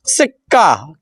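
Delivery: सिक्का said low with a clipped, doubled क्क; doubled k demo. Shown as a demonstration. Teacher demonstrating the doubled consonant in सिक्का. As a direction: hold the क्क briefly in सिक्का. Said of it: "का जल्दी बोला इसमें".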